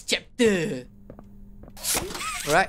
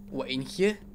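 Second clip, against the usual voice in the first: ordinary talk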